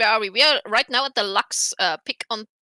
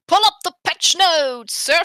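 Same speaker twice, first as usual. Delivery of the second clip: mock command; 7 semitones higher, 8 dB louder than usual. Playful order to co-caster.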